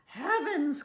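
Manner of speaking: high, loud exclamation; shocked cry. A narrator's reading. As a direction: cry out in storybook dismay. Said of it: "cries the farmer's wife".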